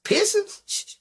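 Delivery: high squeak of disbelief with a laugh. Real, not acted. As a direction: shoot up high, amused and shocked.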